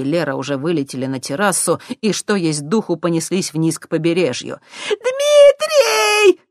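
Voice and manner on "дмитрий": high, loud crying shout; wailing yell. Acted. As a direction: wail the name across the yard. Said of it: "раздался вопль". (отчаянный вопль старухи)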